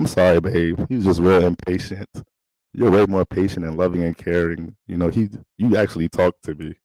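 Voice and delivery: normal talking voice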